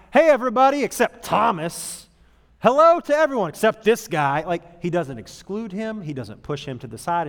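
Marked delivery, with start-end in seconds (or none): 0.1-4.4 loud, mock cold greeting that leaves one guy out; mock snub. Jesus snubbing Thomas in front of everyone, mock loud. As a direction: call out hello to all but him, loudly. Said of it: "Jesus' reaction is not".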